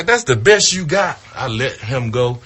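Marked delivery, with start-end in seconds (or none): 0.0-1.2 much higher, louder mock taunt; taunting shout. Rookie taunting the manager after the punch, loud mock taunt. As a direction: throw it back at him with a yell.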